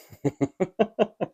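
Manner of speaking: a light, affectionate laugh; kind gentle laugh. Real, not acted. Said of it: "I'm laughing 'cause it's cute".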